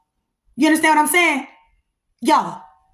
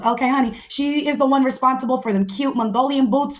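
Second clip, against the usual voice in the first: normal talking voice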